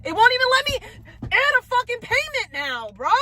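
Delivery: real fury when the payment fails; exasperated outburst. Real, not acted. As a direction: explode in disbelief at the app. App refuses payment, exploding.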